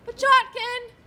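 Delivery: loud call across the office. Acted. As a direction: summon the clerk. Calling out for clerk.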